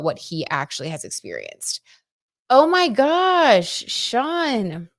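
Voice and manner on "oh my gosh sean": high, loud, drawn-out oh my gosh; stunned gasp. Real, not acted. Streamer amazed by Sean's growth, drawn-out surprised exclaim. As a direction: let the surprise stretch out slowly.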